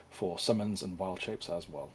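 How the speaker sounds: ordinary delivery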